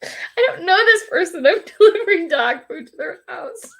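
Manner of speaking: can't stop giggling while telling it; laughing. Real, not acted. Laughing as she tells viewers about the hug.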